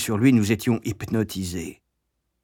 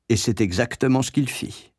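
plain reading voice

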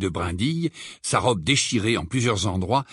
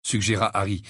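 ordinary delivery

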